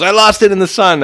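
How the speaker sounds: high, loud mock excuse; imitating a player pleading he lost the ball. Imitating a player with a high, loud mock excuse for the dropped ball. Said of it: "he goes".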